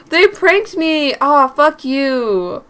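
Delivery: loud, drawn-out indignant retort; outraged. Acted. Prankster's friend protesting being pranked, loud, slow and outraged. Acted quarrel about the prank.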